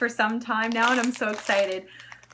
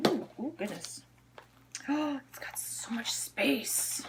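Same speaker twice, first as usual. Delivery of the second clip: awed whisper; 9 dB quieter than usual. Real, not acted. Vlogger whispering in awe over her new wallet. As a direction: hush it like a secret treasure.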